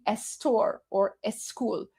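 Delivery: "estor" and "escul" with a leading e; extra vowel before S. Shown as a demonstration. Streamer explaining the accent, leading vowel on "estor" and "escul". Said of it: "the S is only if it's".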